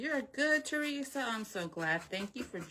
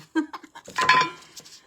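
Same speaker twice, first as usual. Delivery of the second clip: high, loud burst of laughter; squealing cackle of amusement; laughing at herself; 12 dB louder than usual. Real, not acted. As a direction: burst out laughing at your own joke. Laughs at her own singing joke.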